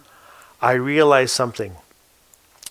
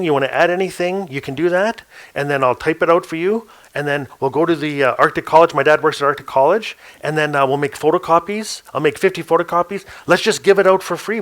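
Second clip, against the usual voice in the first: eager pitch; 3 semitones higher than usual. Retold or imitated. Young storyteller eagerly begging the elder to let him record her. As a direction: rush the plan out like an excited kid.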